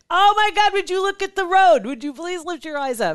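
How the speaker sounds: high and loud, pleading with a bad driver; exasperated yelling. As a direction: blow up at the driver ahead, then let it sag. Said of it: "And you're just like".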